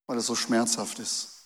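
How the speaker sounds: ordinary talk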